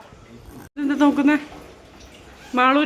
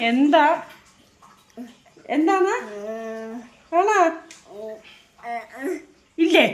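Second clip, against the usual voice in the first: put-on child's question; 3 semitones higher, 4 dB louder, slower than usual. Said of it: "ആ കുട്ടി എന്നോട് ചോദിക്കുവാണേ".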